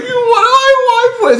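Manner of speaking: put-on high, slow crying little boy; mock tearful child; whiny kid. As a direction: wail it like a helpless kid.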